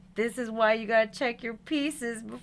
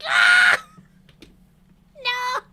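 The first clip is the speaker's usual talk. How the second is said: frustrated scream; 13 dB louder than usual. Real, not acted. Frustrated outburst.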